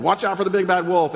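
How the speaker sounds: high, loud mock warning from the pigs' dad; warning father. Pig dad warns his sons leaving home, high and loud mock warning. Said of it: "their dad said".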